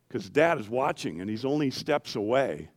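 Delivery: usual speaking voice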